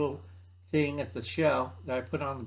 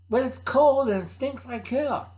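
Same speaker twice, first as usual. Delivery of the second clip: put-on high, louder kid whine; whiny kid; 8 semitones higher, 5 dB louder than usual. Kid complains to Uncle Al about the smelly tent, loud high whine.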